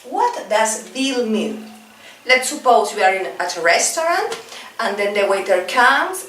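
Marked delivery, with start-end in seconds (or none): 0.1-1.7 slow and clear; deliberately slow example question; slow teaching model. Shown as a demonstration. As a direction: spell it out for learners. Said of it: "for example".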